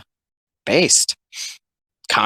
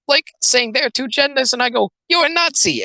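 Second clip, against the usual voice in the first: squeaky mock accuser, pitched way up; mocking high voice; 12 semitones higher than usual. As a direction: mimic the outraged caller in a shrill falsetto. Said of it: "The joke is it's like".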